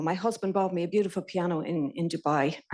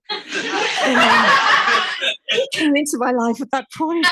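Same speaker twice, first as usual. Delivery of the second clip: higher and louder, bursting into laughter; laughing; 8 semitones higher, 10 dB louder than usual. Real, not acted. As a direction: laugh as the line lands. Laughs about her new boss.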